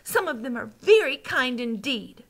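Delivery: indignant correction; angry protest. A narrator's reading. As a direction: argue back crossly as a child character. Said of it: "Sophie cried angrily".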